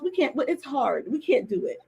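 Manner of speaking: ordinary talk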